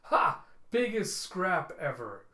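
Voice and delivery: loud, slow gloating cry; a brute crowing over the wreckage; gloating villain. Acted. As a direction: revel in the destruction, out loud. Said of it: "just reveling in it as he goes".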